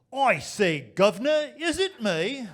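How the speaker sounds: loud, high hammed-up British toff, I say governor; British accent. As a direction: blurt the punchline as a pompous Englishman. Judas punchline in British voice.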